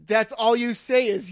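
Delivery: words broken by laughter; loud laughing delivery; laughing while talking. Real, not acted. Laughing at friend's one-word replies.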